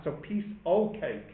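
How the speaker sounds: "o'" said as plain "oh", the f gone; dropped f in o'. Shown as a demonstration. Teacher showing students the emphatic "o'". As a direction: run "piece o' cake" together with "o'" for "of". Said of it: "They drop the f".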